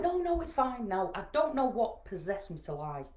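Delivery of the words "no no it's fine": high, hurried-to-reassure denial; acted false reassurance. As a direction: brush off the worry with a raised pitch. Lying brightly to her daughter.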